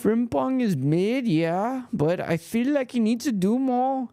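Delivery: put-on high-pitched Liverpool Scouse accent; a rough go at a Scouse accent. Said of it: "Trying to do my Scouse accent".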